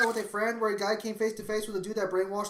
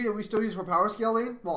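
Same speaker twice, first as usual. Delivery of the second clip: normal talking voice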